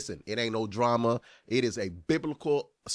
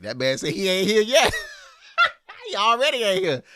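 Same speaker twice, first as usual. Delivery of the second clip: laughing through the words, higher; laughing disbelief; 11 semitones higher, 6 dB louder than usual. Real, not acted. Reacting to the preacher clip, laughing in disbelief. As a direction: crack up at what the preacher said.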